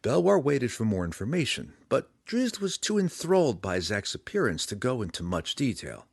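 usual speaking voice

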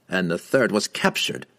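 ordinary delivery

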